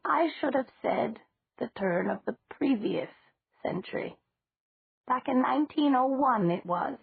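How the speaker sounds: a hint of a cheerful old woman correcting herself; elderly landlady. Old landlady voiced in dialogue.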